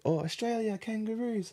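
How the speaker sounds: high, eager hawker calling out; mock the sellers' pushy welcome; hammed-up street vendor greeting; market seller voice.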